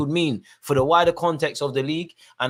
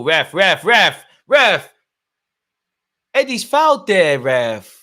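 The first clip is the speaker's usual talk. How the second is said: loud calls getting higher and louder; repeated appeal; 4 semitones higher, 9 dB louder, much slower than usual. Real, not acted. Fan shouting at the ref on TV for a foul, insistent. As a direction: hammer the ref's name, demanding a foul.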